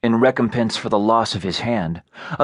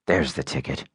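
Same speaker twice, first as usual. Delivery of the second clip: かなり低く抑えた男の声; 喉の奥から低く沈んだ声で; low voice; 9 semitones lower, 6 dB quieter than usual. A narrator's reading. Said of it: "said Low Voice".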